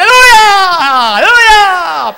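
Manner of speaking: very high, loud, slow wailing aleluya; the raging neighbor howling from outside; furious mock aleluya. Angry neighbor yelling, throwing stones. (vecino furioso gritando aleluya entre piedras)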